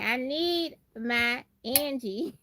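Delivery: normal talking voice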